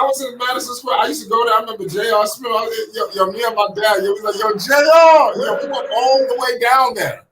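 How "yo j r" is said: high, loud shout across the arena; yelling a name. Retold or imitated. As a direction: holler to get the player's attention. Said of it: "you gotta yell at them".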